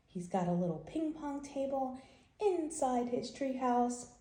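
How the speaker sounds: usual speaking voice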